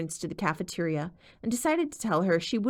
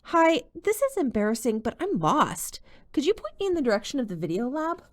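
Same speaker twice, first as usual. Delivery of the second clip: bright smile; 7 semitones higher than usual. A narrator's reading. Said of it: "she put on a bright smile".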